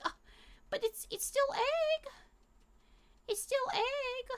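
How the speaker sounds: very high, squeaky coaxing; mock-sweet high plea that it is still egg; high coaxing mom voice. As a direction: coax the dog in a tiny high voice. Mom reasoning with dog.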